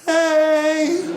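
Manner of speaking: high, cheerful little "Hey!"; friendly call. Acted. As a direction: shout a sweet hello across traffic. Acted friendly call to driver.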